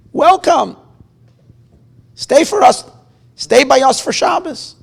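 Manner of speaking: loud, high, eager invitation; a host beaming at his guest; eager welcome. Acted. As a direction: call him in with open arms. Old man's warm invitation.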